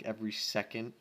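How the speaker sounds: ordinary delivery, nothing marked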